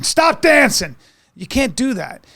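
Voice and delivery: high, loud scolding chaperone; stern prim chaperone. Retold or imitated.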